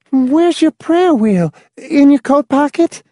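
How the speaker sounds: put-on high girl voice. Girl's voice.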